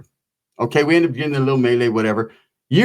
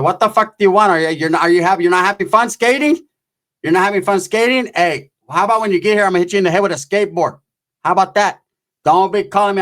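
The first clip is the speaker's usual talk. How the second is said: raised, exasperated aunt on the phone; mock telling-off, pitched up; scolding aunt; 3 semitones higher than usual. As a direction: rattle off annoyed questions and threats.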